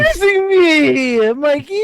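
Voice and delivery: high, loud, drawn-out cry, falling off at the end; mock wail. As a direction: howl it in hammed-up distress. Acting out the son's protest.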